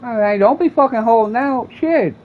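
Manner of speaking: mock yell. Continues mocking the bum.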